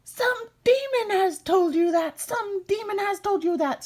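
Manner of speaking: high, loud furious imp scream; raging high goblin shriek; screamed in rage. Acted. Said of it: "screamed the little man".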